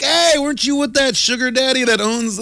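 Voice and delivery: high teasing call-out; teasing recognition. Pretend. Spotting the sugar daddy's girl, high teasing. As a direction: needle her with a high voice. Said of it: "I'm like".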